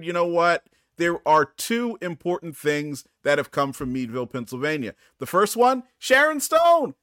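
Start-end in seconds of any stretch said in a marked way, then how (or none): 5.2-6.9 much higher and louder announcement; excited reveal. Real, not acted. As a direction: announce it like a big surprise. Big reveal of celebrity.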